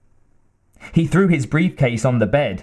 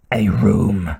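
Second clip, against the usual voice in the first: lower, curt menacing stranger; gruff villain voice; 4 semitones lower than usual. Acted. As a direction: drop the voice for a terse killer. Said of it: "said the Kurgan".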